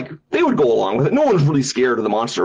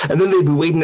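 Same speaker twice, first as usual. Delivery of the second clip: usual speaking voice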